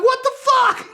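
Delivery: high, loud stunned cry; shocked outburst. Retold or imitated. Sebastian stunned after the fake bottle smash, high loud cry.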